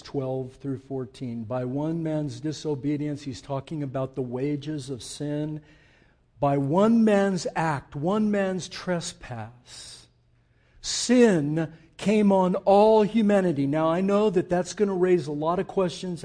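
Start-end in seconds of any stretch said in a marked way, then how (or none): 6.4-13.7 "one" drawn out slowly; slow weighty reading. Acted. Preacher reading verse slowly, solemnly. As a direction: linger on every "one man's".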